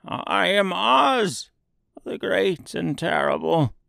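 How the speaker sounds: high, trembling little man. A narrator's reading. Little man facing an axe, trembling voice. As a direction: falter high and slowly in fear. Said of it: "said the little man in a trembling voice".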